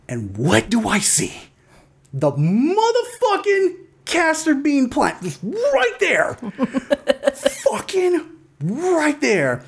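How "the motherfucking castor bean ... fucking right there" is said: high, drawn-out incredulous outrage; mock-outraged, slow and high; exasperated disbelief. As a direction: stretch each word out in high-pitched exasperation. Exasperated reveal of the plant.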